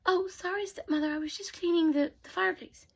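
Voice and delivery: meek apology. Acted. Cinderella apologising.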